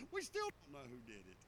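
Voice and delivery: usual speaking voice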